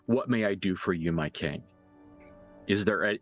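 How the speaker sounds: ordinary talk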